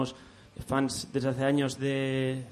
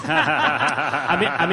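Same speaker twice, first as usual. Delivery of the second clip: laughing; 7 semitones higher, 9 dB louder, slower than usual. Real, not acted. Laughing at being called leader.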